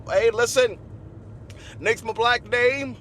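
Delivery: ordinary delivery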